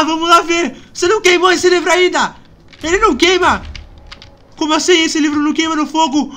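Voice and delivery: loud, high scared pleading; frightened pleading. Real, not acted. Scared player begging Flapjack to do something. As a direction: beg for help, half panicking.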